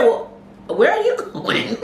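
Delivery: slow, high, laughing disbelief; high drawn-out where are you going, through a laugh; startled question. Retold or imitated. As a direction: drag it out in amused shock. Retold shocked question to friend.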